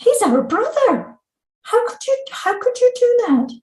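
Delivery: high-pitched, hurt accusation; outraged daughter. Retold or imitated. Older daughter confronting her mother, high and indignant. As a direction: fire the questions at mum in disbelief. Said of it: "my older daughter said".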